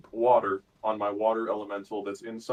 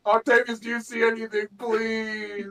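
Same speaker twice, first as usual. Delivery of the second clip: high, loud panicked calls; shouting; 12 semitones higher, 7 dB louder than usual. Acted. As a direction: yell out for a missing ally. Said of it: "like I'm shouting to one of my comrades".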